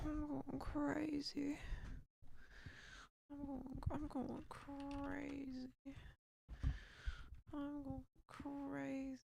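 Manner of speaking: slow, stretched-out lament; drawn-out exasperation. Real, not acted. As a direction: drag out each word as you repeat it. Repeated lament of losing it.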